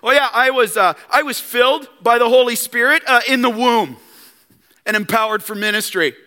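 casually flaunt it; smug bragging. Retold or imitated. John boasting to the leaders, smug. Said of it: "our flesh wanna do this".

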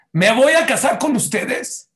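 high, loud, slowed vow; fervent proclamation. Acted. God vows marriage to his people, fervent and loud. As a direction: proclaim it as a ringing promise. Acts God's emphatic vow.

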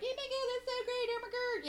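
high gushing squeal; gushing women. Retold or imitated. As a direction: pitch way up, mock delight. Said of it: "all these women are like".

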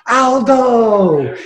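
high, drawn-out mock announcing yell; yelled name. Prankster calls a missing artist's name at gallery, mock yell. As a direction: call it out high, as if across a crowd. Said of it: "I'm gonna yell".

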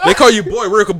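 usual speaking voice

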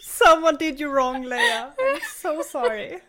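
laughing, high and loud; mock pity, laughing hard. Real, not acted. Laughing sympathy for Leia.

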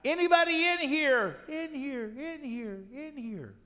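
loud high call into the cave, fading on the repeats. Retold or imitated. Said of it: "I hear 'em calling out".

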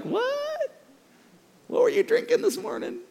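put-on high incredulous teen voice; stunned disbelief. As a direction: gasp it out in total disbelief. Son stunned mom says yes.

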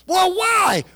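high, pressing why; pushy spouse. Retold or imitated. Spouse demanding a reason, high and pressing. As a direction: push back hard, high and sharp. Spouse quarrel back and forth.

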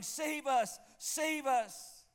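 high, loud pleading cry; crowd shouting. Retold or imitated. Preacher voicing the palm-waving crowd, loud high plea. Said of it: "they're shouting".

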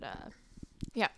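normal talking voice

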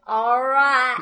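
loud mock Southern accent; a hint of a hammy Southern accent; bad Southern accent. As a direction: imitate an actor's worst Southern accent. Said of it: "Give me your worst Southern accent".

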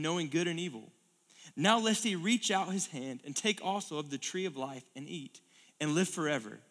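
scripture reading. Acted. Preacher reading Genesis to the congregation, solemn. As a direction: read it with weight.

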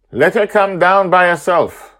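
high, loud call; shouting. Acted. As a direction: shout it across the sky. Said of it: "Madeline shouts at him".

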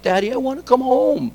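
put-on high, small-child voice, pleading; a young girl begging dad to come home; daughter's plea. Father voicing his upset daughter on the phone, high and pleading. Daughter calls from movie night.

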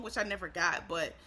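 ordinary delivery

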